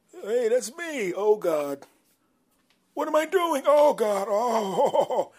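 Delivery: high, loud, horrified crying out; horrified wail. Acted. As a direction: panic at seeing yourself on film. Friend horrified seeing the video.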